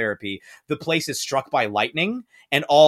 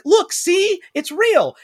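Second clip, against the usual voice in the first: high, louder eager insistence; excited Dorothy; 5 dB louder, slower than usual. Retold or imitated. Dorothy showing her aunt the Oz key, high eager cry. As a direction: prove it to them, bursting with excitement. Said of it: "And she's like".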